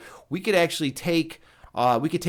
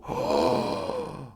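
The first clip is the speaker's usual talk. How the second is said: a high, dramatic gasp; high gasp of horror. Real, not acted. Mock horror at forgetting JT.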